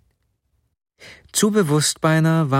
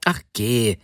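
a touch of hurt; slightly offended. A narrator's reading. Wife to her joking husband, slightly offended. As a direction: brush it off, mildly miffed. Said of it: "meinte die Frau etwas beleidigt".

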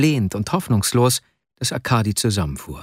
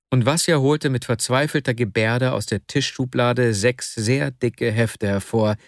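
ordinary delivery

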